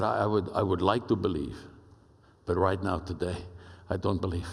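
low-pitched imitation of a Spanish accent; Pablo's deep Spanish accent. Ambassador admitting doubt to his friends, imitated deep Spanish accent. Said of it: "deep Spanish accented voice like this".

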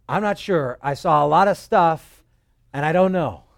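loud, breathless, gasping the news out; breathless messenger. Retold or imitated. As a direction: blurt it out like a runner who has just arrived. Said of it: "he's just panting and out of breath".

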